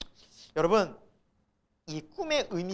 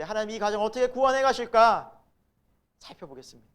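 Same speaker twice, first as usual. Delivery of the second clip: normal talking voice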